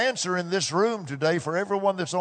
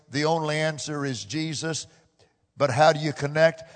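usual speaking voice

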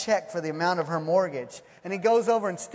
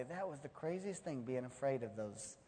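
soft, low aside; hushed reflection; 7 semitones lower, 15 dB quieter than usual. Acted. Looking back from heaven on old fears, hushed. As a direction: drop to a quiet musing.

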